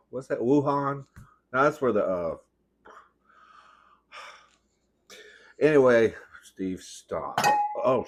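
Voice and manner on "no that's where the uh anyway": normal talking voice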